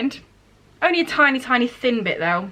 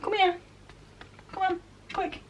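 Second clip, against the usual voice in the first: high, soft coaxing call to a cat; 7 semitones higher, 9 dB quieter than usual. Real, not acted. Talking to the cat on the shelf, high soft coaxing. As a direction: sweetly coax the cat down, higher and softer.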